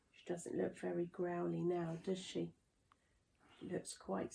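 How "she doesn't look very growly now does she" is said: usual speaking voice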